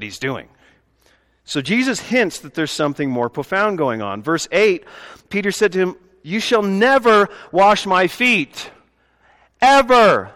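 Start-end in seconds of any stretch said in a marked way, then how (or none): 6.2-10.3 EVER pushed out high and loud; emphatic refusal. Retold or imitated. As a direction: plead it, hammering the last ever. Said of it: "Peter's very emphatic".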